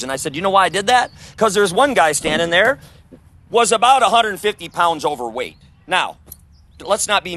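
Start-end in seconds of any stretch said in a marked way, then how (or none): 0.3-5.6 calling out loud to the crowd; raised retold call-out. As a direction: project it over a crowd, louder and higher. Retelling his remark to the crowd.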